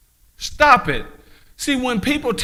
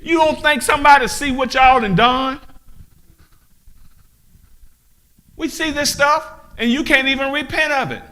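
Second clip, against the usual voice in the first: high, loud accusing rebuke; 7 semitones higher, 4 dB louder than usual. Real, not acted. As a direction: shout it at the oppressors. Preacher rebukes loudly.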